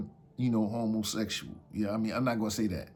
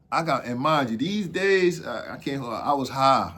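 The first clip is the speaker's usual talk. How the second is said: ordinary delivery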